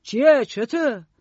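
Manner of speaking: loud, high, startled questions. A narrator's reading. Mr Grute reacts to her pushing the child, loud astonishment. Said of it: "با تعجب نگام کرد و گفت".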